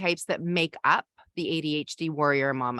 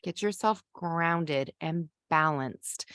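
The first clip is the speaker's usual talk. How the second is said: ordinary delivery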